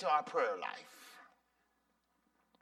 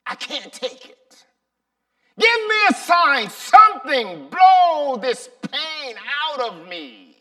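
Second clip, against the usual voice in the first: shouting, pleading at God, louder and higher; angry desperate prayer; 4 semitones higher, 11 dB louder than usual. Acted. Sonny praying after fleeing, furious then pleading. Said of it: "this is his prayer".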